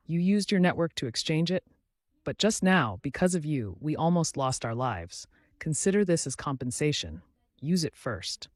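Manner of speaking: usual speaking voice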